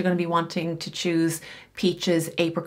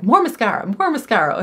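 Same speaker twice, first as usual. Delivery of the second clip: Michelle imitation; 4 semitones higher, 6 dB louder, faster than usual. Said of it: "she's like".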